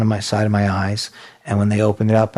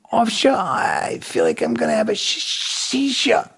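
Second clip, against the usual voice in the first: put-on stammer, dragging and swooping high; feigned seizure onset; 8 semitones higher than usual. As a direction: fake a helpless stuttering fit warning. Feigns impairment and an oncoming seizure.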